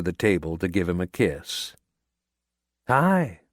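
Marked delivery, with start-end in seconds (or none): 2.9-3.4 higher, light female voice; young woman voice. A narrator's reading. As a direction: brighten up for her hello. Diane greets Cal.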